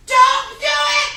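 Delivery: loud, high, drawn-out yell. Acted. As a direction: yell a desperate warning at the book. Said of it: "you're yelling at the book".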